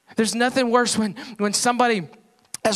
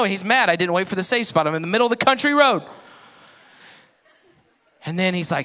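normal talking voice

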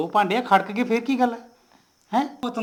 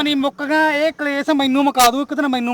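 exasperated outburst; 5 semitones higher, 6 dB louder than usual. Acted. Nephew complaining to aunt.

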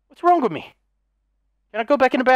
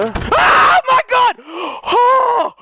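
slow, high, loud shriek; 11 semitones higher, 4 dB louder, much slower than usual. Real, not acted. Gamer shrieking at a sudden scare. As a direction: let out a terrified shriek.

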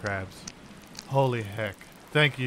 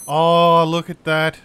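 loud exasperated oh at the bad news; loud exclamation; 4 semitones higher, 11 dB louder, slower than usual. Real, not acted. Blurt it out loud in dismay. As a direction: gamer seeing crabs on his home island, loud dismay. Crabs have infested his island.

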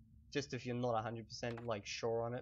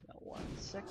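whispered aside; 6 semitones higher, 7 dB quieter than usual. Real, not acted. Distracted while gaming.

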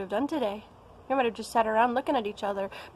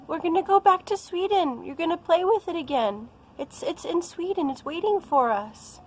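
put-on reassuring a child; 7 semitones higher than usual. Mom reassures upset little daughter.

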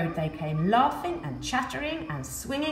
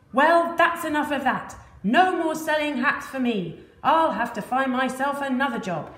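loud, higher fed-up declaration; giving up; 5 semitones higher, 5 dB louder than usual. Acted. Fed-up hat seller quitting, loud exasperated. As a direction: throw it all in, exasperated. Man gives up, throws hat.

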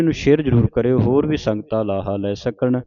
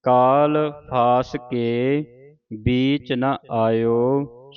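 very slow recitation, pause after ਕਾਲ ਫਾਸ ਕੇ; marked pause; much slower than usual. Shown as a demonstration. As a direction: draw out ਕਾਲ ਫਾਸ ਕੇ, rest, then finish. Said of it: "ਇੱਥੇ ਵਿਸਰਾਮ ਹੈ".

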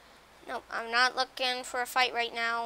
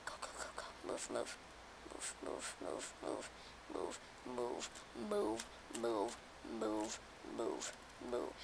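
urgent whisper; 12 semitones lower, 13 dB quieter than usual. Real, not acted. Fleeing mobs urgently.

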